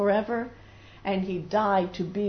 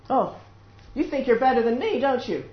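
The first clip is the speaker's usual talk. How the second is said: raised, louder acted challenge; quick, taunting jab; resentful accusation; 5 semitones higher, 5 dB louder than usual. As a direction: accuse them of acting superior.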